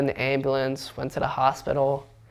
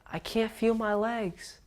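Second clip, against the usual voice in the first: higher, drawn-out mock distress; mildly frightened quote, pitched up and slowed; retold alarm; 8 semitones higher, 6 dB quieter, slower than usual. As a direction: replay the scary moment in a high, stretched voice. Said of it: "one of the scariest moments of my life".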